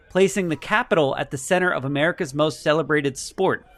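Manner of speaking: measured press-release reading voice. Acted. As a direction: read it like an official announcement.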